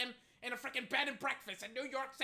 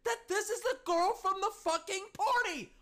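high, laughing despair; mock anguish; 10 semitones higher than usual. Real, not acted. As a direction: wail it in hammed-up agony. Anguished rant over singer.

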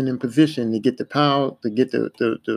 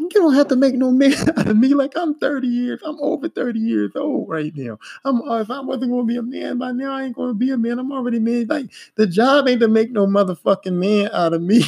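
high, indignant retold rant; so mad; 9 semitones higher than usual. Apprentice over thirty answering the foreman, so mad. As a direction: rant back with the pitch up. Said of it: "I was so mad".

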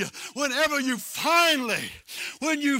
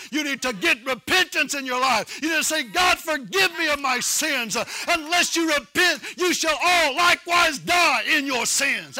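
fiery repentance sermon, pitch raised; forceful preaching; 3 semitones higher, 5 dB louder than usual. Real, not acted. Preacher warning the congregation to repent, forceful preaching. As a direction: drive the warning home like a revival preacher.

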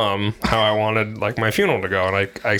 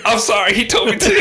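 imitating a friend apologizing, higher, through laughter; mock nervous apology, pitched up and giggling; sheepish giggly apology; 12 semitones higher, 4 dB louder than usual. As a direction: squeak out a guilty sorry while laughing.

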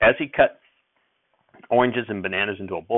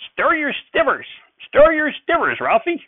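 put-on high, loud snapping old man; snapped; 6 dB louder than usual. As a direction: bark it at the boy in a dither.

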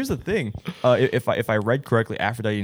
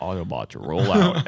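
Optimus Prime impression; 4 semitones lower, slower than usual. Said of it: "it's just like".

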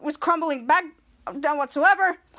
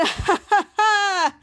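laugh; 4 semitones higher, 3 dB louder than usual. Real, not acted. Host finishing the acted recap, laughing. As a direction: burst of laughter in separate pulses.

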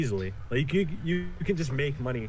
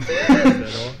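teasing shout; 13 semitones higher, 13 dB louder, slower than usual. Real, not acted. Teasing a sleepy friend.

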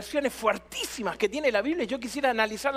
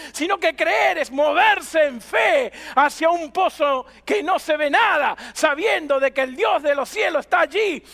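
emphatic pulpit build into a shout; fervent preaching; 6 semitones higher, 7 dB louder than usual. Real, not acted. Pastor urges congregation to believe and jump, fervent preaching. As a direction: drive the message home, stretching key words.

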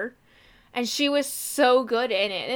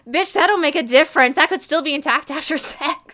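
loud, raised mock outrage; indignant retort; 4 semitones higher, 7 dB louder than usual. Retort to an actor checking on his daughter, indignant. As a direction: fire it back, incredulous and loud.

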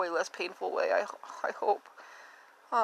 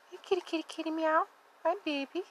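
pet-talk voice; 8 semitones higher, 4 dB quieter, faster than usual. Real, not acted. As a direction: coo at the kitty. Talking to the kitten.

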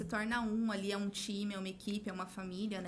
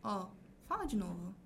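calm request; 5 semitones lower, 5 dB quieter than usual. Retold or imitated. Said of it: "quando a gente escuta".

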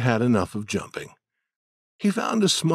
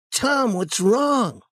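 put-on child voice; 13 semitones higher, slower than usual. Sid voiced by narrator.

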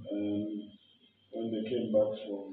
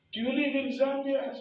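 much higher, incredulous challenge; scornful disbelief; 13 semitones higher than usual. Retold or imitated. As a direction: sneer at the clerk for not knowing him. Offended minister questioning the clerk.

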